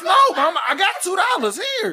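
put-on high-pitched child pleading with mama; hammed-up squeaky defense; pleading protest. Child protests to accusing mother.

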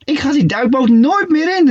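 high excited call. A narrator's reading. Shark calls out vowing never to return. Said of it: "riep ze".

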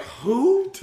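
high, loud mock confusion; hammed-up puzzled reply; baffled question. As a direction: toss it back clueless. Everyone's imagined baffled reply.

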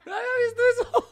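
high, loud, fast words through laughter; laughing hard. Real, not acted. Host sees the drawing and bursts out laughing high and loud. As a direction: crack up mid-sentence.

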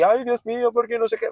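high whiny mock fretting; mocking a panicky woman. Mocking the woman who told him to wear a mask, high whine. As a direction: whine it up high like a fussy worrier.

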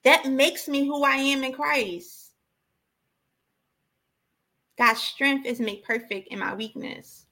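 ordinary talk, nothing marked

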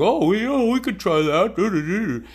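high, drawn-out hesitant stalling; mock uneasy agreement. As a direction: play along reluctantly, caught out. Cornered comic feigns agreement.

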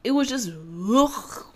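usual speaking voice

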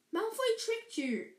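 high-pitched, indignant accusation; lightly voiced indignant girl; scolding. Hermione to Harry about the trick, scolding.

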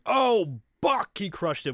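excited announcer call, high and loud; mock play-by-play shout; commentator call. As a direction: hype the home run like a TV commentator. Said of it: "the commentator's like".

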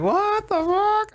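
high, drawn-out astonished "what"; retold amazement. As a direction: stretch it out high in mock disbelief. Amazed reaction to discovery retold.

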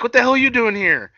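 high, loud mock challenge; imitated confrontation. Acting Hardy confronting Sammy.

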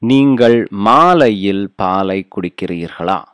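clear, paced Tamil model of நீங்கள் மாலையில் பாலை குடிக்கிறீர்களா; teacher says நீங்கள் மாலையில் பாலை குடிக்கிறீர்களா carefully for students to copy; model Tamil phrase. Shown as a demonstration. Teacher modelling Tamil for learners, clear and careful. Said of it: "How do you say that?".

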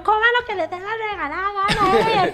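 frazzled mother screaming, slowing on estoy harta; retold yell. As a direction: blow up at the kids, half laughing. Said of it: "yo exploté y les grité".

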